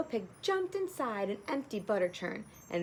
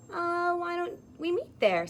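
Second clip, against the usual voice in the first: pig; 8 semitones higher, 5 dB louder than usual. Acted. Pig answers wolf.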